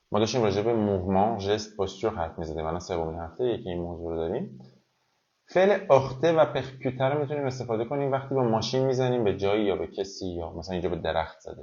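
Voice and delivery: normal talking voice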